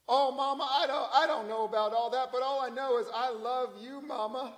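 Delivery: a guilty son softening up his mama; son's voice. Retold or imitated. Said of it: "And he said".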